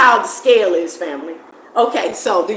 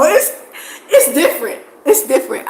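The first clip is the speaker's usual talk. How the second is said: laughing; 4 semitones higher, 3 dB louder than usual. Real, not acted. Laughing at the contrast.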